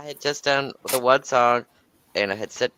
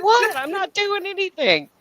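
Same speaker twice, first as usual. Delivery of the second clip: much higher, wide-eyed "who, me?" voice; fake innocent. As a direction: play dumb in a high voice. Feigning innocence to the wife.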